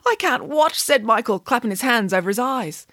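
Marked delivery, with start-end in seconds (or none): 0.1-0.8 put-on boy voice. Michael fears the scary part.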